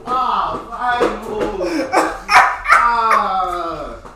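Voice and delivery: loud, drawn-out "ah" of protest; loud protesting wail. Real, not acted. Friend exposed by the story, loud protesting wail.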